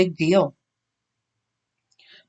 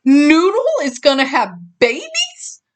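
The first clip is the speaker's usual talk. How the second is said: amazed; 7 semitones higher than usual. A narrator's reading. Skeeter in disbelief.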